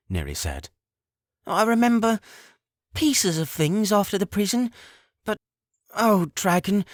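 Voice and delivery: put-on high, lighter frail old mother; fading to weak at the end; a hint of a frail mother. A narrator's reading. Neri asks her son for help, frail and fading. Neri's voice, weak after healing.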